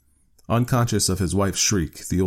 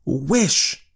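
higher-pitched firm command; insistent repeat; 9 semitones higher than usual. A narrator's reading. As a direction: repeat the order, allowing no refusal. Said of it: "repeated his wife".

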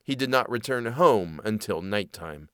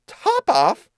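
high, squeaky mouse exclamation; astonished cry; 4 dB louder than usual. Acted. Mouse hearing an odd name, crying out high. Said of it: "cried the mouse".

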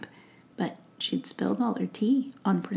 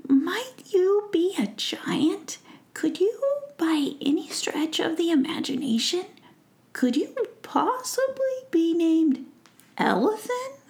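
curious fairy prince; 10 semitones higher than usual. Acted. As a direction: ask the tree giant politely. Orion asks the giant.

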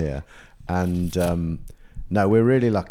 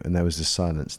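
ordinary talk

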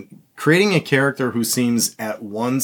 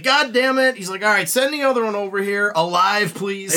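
imitated film lieutenant, much higher pitch, mildly exasperated; Aldo Raine; 10 semitones higher than usual. Retold lieutenant interrupted at lunch, imitated high exasperated voice. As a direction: play a put-out boss calling out an order. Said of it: "And he's like".

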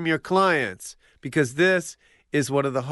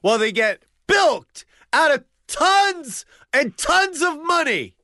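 ranting shout; 7 semitones higher than usual. Acted. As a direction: rant it at full volume. Rant about dentists.

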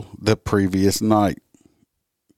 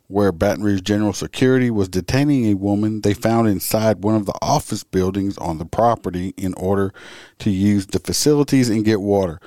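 ordinary talk

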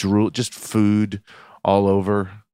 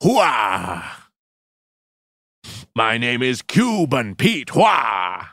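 Al Pacino; slower than usual. Retold or imitated. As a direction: shout it like a hammy war hero. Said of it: "Is that Al Pacino?".

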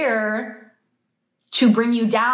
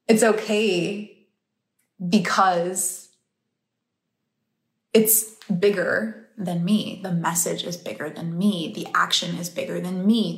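ordinary delivery

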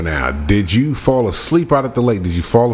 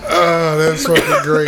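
laughing; 7 semitones higher, slower than usual. Real, not acted. Amused reaction.

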